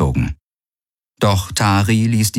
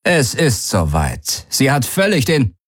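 the man, cut off; 7 semitones higher than usual. Acted.

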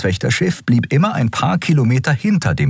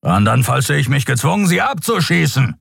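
higher, stern order from a warship captain; commanding captain; 10 semitones higher than usual. A narrator's reading. Captain orders the fleeing ship to halt, stern. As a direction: bark the command.

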